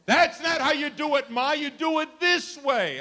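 high, loud mock know-it-all kid; bossy child. Young child telling Ma how to run the house, high and bossy. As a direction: boss Ma around like a little kid. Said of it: "kids tell you how to run the house".

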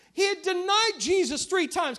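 usual speaking voice

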